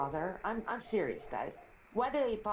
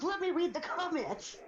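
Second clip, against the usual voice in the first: very high, mock-outraged demand; mock yelling son; 10 semitones higher than usual. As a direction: imitate an exasperated son, pitched way up. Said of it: "he yelled at me".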